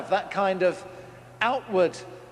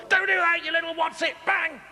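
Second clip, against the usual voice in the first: mock temper; 12 semitones higher than usual. Parent losing temper at child, hammed-up rage. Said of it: "I know what some of you do".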